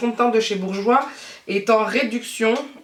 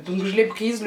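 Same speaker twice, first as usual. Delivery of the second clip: ordinary talk, nothing marked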